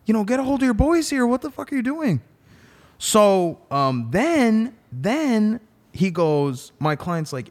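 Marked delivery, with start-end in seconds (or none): 0.1-2.2 high, fast retold protest; indignant. Lawyer telling the prosecutor to control his agents, indignant. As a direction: demand an explanation, pitch raised and words quick.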